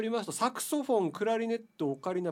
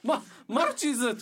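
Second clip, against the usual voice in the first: stammered, high, loud password with cracking voice; 6 semitones higher, 6 dB louder than usual. Pretend. Host pretending to summon courage at reception, voice cracking. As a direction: crack upward as you force it out, half laughing. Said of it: "裏、裏返りながらな".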